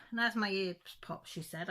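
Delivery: usual speaking voice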